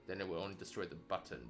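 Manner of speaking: normal talking voice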